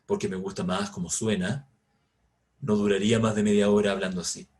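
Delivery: very low, put-on deep voice; artificially deep voice. Shown as a demonstration. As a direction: press the voice down low. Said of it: "con una voz así".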